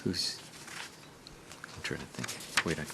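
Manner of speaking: whispered aside to self, barely voiced; whispering. Real, not acted. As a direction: mutter it under the breath to yourself. Chair muttering aside off-mic.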